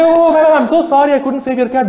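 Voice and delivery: high, loud hammed-up apology; mock apology. Firefighter apologising to the cat owner, hammed-up high and loud.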